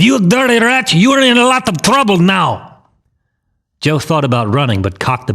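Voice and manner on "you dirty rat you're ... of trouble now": high, strained gangster yell; angry yell. A narrator's reading. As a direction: yell a threat, pitched way up. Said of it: "Campanella yelled".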